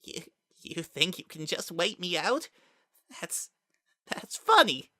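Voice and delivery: taunting Kara. Acted.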